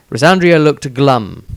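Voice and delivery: plain reading voice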